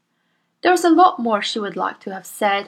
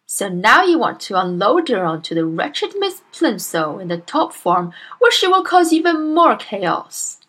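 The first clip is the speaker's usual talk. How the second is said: loud, booming bully of a headmistress; Miss Trunchbull; 3 semitones higher, 5 dB louder than usual. Acted. Bullying headmistress scolds Miss Honey, booming and loud. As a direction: bark at the timid teacher like a tyrant.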